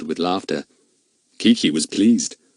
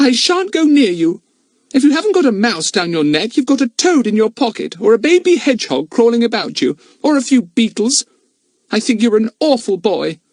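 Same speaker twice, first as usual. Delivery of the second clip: put-on high, loud girl's scolding; a sister scolding her brother over his pets, high and disgusted; 6 dB louder than usual. A narrator's reading. Dinah keeps scolding Philip.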